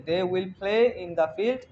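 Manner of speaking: slow model with a rising end; slow repeat. Shown as a demonstration. Teacher repeating the model sentence slowly for the class.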